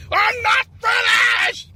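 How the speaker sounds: high, very slow, shouted; drawn-out protest. Acted. Candidate refusing to be cut off, slow high shouted protest. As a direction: drag it out, refusing to stop.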